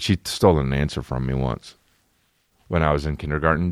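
ordinary delivery

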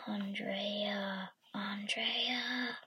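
eerie ghostly whisper; spooky whisper, drawn out; haunting voice. Acted. Ghost call repeated in dark.

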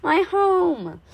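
high, slow, loud lament; monster's groan. A narrator's reading. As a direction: wail over what was lost. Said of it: "he groaned".

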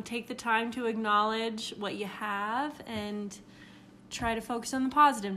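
ordinary talk, nothing marked

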